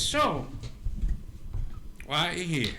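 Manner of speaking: a hint of an old woman questioning visitors; older woman. Older woman addressing the seated group, lightly in character. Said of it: "she looks at the group and she goes".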